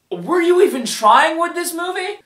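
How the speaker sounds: high-pitched, incredulous challenge to the filmmakers; exasperated, pitched well up; incredulous question. Acted. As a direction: ask it in high-voiced disbelief.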